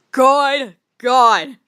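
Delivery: loud, stunned disbelief; stunned exclamation. Real, not acted. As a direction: blurt it out in shock. Shocked reaction to fanfic.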